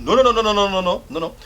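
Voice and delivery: loud, high rapid-fire refusal; imitated irate officer, loud and high; irate soldier. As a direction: cut him off with insistent no's. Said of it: "he got very, very irate".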